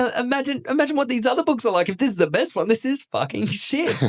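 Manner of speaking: high-pitched comic disbelief; mock exasperation. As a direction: act appalled, voice up high, dragging the last words. Acted disgusted reader of bad book.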